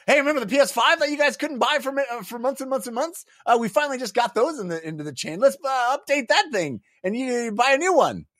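high, fast mock salesman voice; hammed-up cheery company spokesman; corporate pitch voice.

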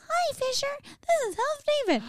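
high squeaky elf voice; chirpy little elf announcing itself. Retold or imitated. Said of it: "And you're like".

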